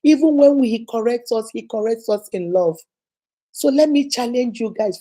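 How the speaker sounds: ordinary delivery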